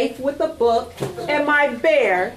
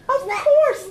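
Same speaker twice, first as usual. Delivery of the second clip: cheerful high reply. Acted. Voiced reply in story.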